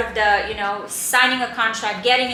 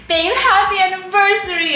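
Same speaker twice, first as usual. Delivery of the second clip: higher, louder gleeful exclamation; excited; 9 semitones higher, 7 dB louder than usual. Retold or imitated. Wife telling her husband she loves the house, gleeful and louder. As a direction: burst out in delight.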